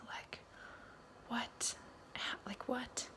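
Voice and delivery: breathy stunned whisper; whispered disbelief. Real, not acted. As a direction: hush it out in disbelief. Stunned by brother news.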